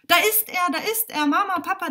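put-on high, loud child calling out; excited shout. A narrator's reading. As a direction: yell it out to the whole family. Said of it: "da schreit seine Schwester Lali".

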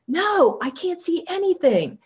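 high, emphatic retold denial; worked-up reply. Answering friend from the balcony, worked up. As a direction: pitch it way up, answering the excitement.

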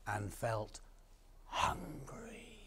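whispered, drawn-out word; hushed storyteller. A narrator's reading. Storyteller tells children how the farmer felt, hushed. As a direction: let it drop to a whisper.

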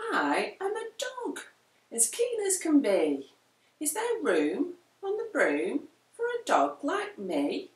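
a keen dog asking to join; a hint of an excited puppy voice; eager dog. A narrator's reading. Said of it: "then eagerly said".

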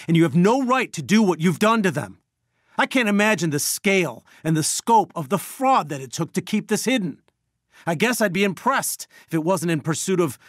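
indignant, high-pitched confrontation; outraged accusation. Acted. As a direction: confront the doctor with rising moral outrage. Angry confrontation with unethical doctor.

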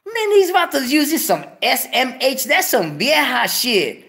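a squeaky high "What?" of mock shock, then mocking; retold disbelief. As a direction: shrieks the first word in disbelief, then scoffs. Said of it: "I'm like".

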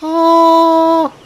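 a sudden high, loud "Oh!"; a gasp of surprise that turns into a word; startled cry. Real, not acted. Startled at seeing the waterfall.

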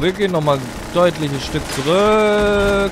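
high, loud hurried retreat; walking on hot sand. Real, not acted. Retreating from mine, raised voice.

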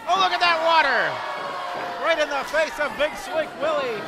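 high, loud excited count; wrestling commentator. Real, not acted. Live play-by-play of the pin.

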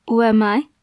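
ordinary talk, nothing marked

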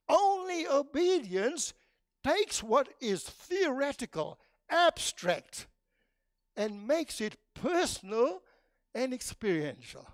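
emphatic preaching. Acted. Preacher stressing that obedience bridges theory and life, loud and emphatic. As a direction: drive the point home with full force.

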